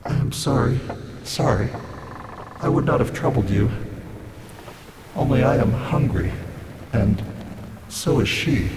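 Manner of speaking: forlorn voice trailing into pauses; hopeless castaway, faltering between phrases; hopeless and pathetic. A narrator's reading. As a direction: plead weakly, with halting apology. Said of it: "extremely hopeless and pathetic".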